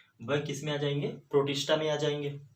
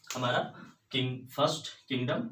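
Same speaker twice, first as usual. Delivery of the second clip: ordinary talk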